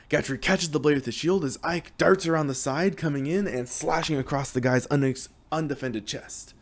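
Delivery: ordinary delivery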